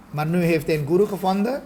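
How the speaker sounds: ordinary delivery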